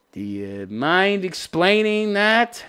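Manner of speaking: normal talking voice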